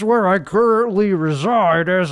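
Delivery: normal talking voice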